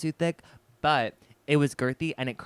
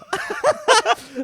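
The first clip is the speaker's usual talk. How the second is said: high, loud peal of laughter; real cackle; 9 dB louder than usual. Real, not acted. Teller after the elementary school reveal, loud cackle.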